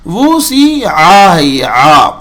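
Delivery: slow وہ سی آ ہے یہ آ, ain from the throat; slow syllable drill. Shown as a demonstration. Teacher shows the ain for students. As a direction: draw out each syllable. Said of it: "غلط ہو جائے گا".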